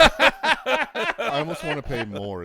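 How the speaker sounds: slow, loud words pushed out through laughter; cracking up while stretching the words; laughing. Real, not acted. Laughing disbelief at cheap kit.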